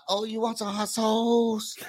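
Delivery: slow, drawn-out mock Vietnamese accent; imitated Vietnamese accent. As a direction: play a Vietnamese waiter taking an order, heavily accented. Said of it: "he would say".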